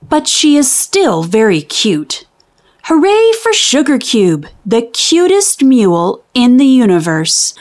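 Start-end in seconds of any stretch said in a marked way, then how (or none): none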